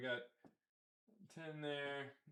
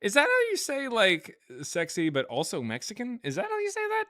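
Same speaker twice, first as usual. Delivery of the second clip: incredulous; 11 semitones higher than usual. Real, not acted. Teasing question about word.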